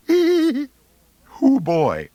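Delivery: high, cracking nervous yelp; a nervous boy's voice breaking high. Acted. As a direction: let the voice crack up high on both words. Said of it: "my voice hardly cracking at all".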